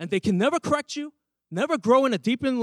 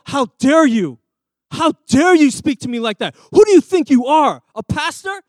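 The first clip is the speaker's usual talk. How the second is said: offended outrage; 9 dB louder than usual. Retold or imitated. Church member scolding the pastor, loud and indignant. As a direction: play the insulted member snapping back at the pastor.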